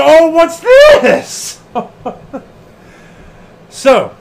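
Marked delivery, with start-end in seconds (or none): 0.0-1.6 high, loud, drawn-out fake surprise; mock innocent surprise. Podcaster voices Gedo finding brass knuckles, in mock surprise, high and loud. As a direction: play dumb, slow and loud, as if amazed. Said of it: "And he's like".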